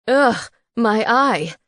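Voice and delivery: loud, slow pained cry. A narrator's reading. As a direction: howl over his eye. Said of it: "Felix cried".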